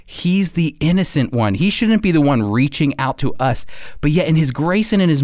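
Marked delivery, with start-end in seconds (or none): none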